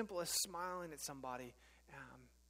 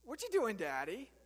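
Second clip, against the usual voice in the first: put-on toddler son repeating; 5 semitones higher, 6 dB louder than usual. Son asks yet again.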